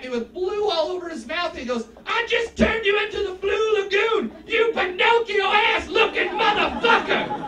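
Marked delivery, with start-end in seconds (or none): 2.1-7.4 loud, high-pitched, stretched-out bellow; triumphant kid shout. Acted. Student taunts blue-sprayed teacher, triumphant shout. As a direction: crow the insult at full yell.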